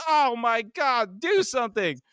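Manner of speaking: high, stretched-out cry for help; panicked yell. A narrator's reading. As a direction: yell high and desperate, not full volume. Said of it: "Huey yells".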